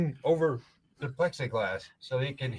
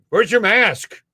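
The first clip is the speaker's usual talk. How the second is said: higher, louder mock guard yelling; mock shouted demand; 8 semitones higher, 8 dB louder, much slower than usual. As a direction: bark it again like a pushy guard.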